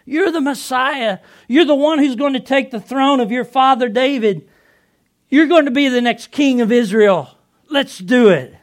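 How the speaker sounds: loud, high-pitched cheering; excited. Acted. Disciple urging his Lord to take the throne, excited. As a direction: shout it with eager, all-in enthusiasm. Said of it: "I was on board".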